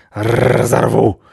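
loud, slow growled threat; barked dog voice; growling guard dog. Acted. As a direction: roll the R into a snarl.

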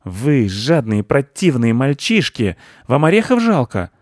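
slow scolding that climbs high; threatening squirrel. A narrator's reading. Squirrel berates boys withholding nuts, indignant. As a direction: scold them, rising to an outraged question. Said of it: "Она грозно зацокала".

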